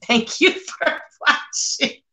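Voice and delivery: mock the snotty fake thanks; mocking sweetness. Mimicking the mean girls' fake-polite thanks, slow and syrupy. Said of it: "they tell me".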